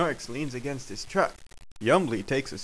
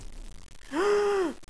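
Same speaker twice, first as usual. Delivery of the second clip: long high gasp; big audible intake of shock; dramatic gasp. Acted. Schnorks reacts to Yumbly doing nothing, gasping. Gasp before surprise attack.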